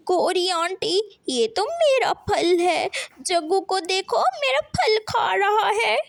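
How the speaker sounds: put-on high, tearful child's whine; crying complaint. A narrator's reading. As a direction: complain to auntie through tears. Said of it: "Guddu रोने लगता है". (रोते हुए शिकायत)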